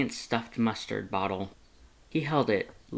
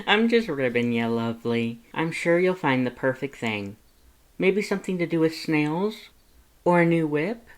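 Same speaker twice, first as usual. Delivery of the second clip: playful teasing, higher voice; teasing Lust; 6 semitones higher, 4 dB louder than usual. A narrator's reading. Lust teasing Frisk about snails and whips, playful. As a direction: rib the human fondly.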